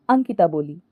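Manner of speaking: plain reading voice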